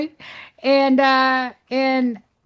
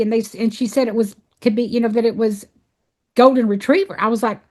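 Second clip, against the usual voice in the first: ordinary delivery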